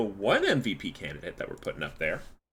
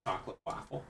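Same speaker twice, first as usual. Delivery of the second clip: whispering; 2 semitones higher, 9 dB quieter, faster than usual. Real, not acted. Whispered aside.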